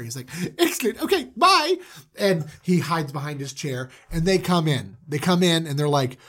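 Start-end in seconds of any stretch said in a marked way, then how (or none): 0.6-1.8 high, giggly villainous delight; gleeful sign-off. Acted. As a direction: chirp a high, loud goodbye. Joseph gleefully dismissing the guards.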